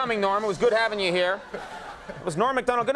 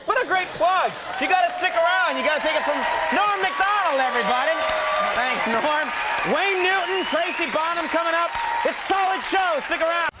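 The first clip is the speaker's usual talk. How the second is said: announcer plug; 7 semitones higher than usual. Acted. Host plugs upcoming show.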